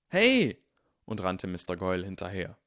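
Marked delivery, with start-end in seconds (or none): 0.1-0.6 sudden high, loud shout; calling out. A narrator's reading. Ernie calls after Goyle as he runs away, shouting. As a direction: yell after someone running off. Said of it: "rief dann".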